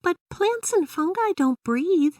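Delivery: put-on high, doubtful young girl; a kid pushing back on her dad; Emily's voice. A narrator's reading. Emily questions her dad about plants, high girlish voice. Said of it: "said Emily".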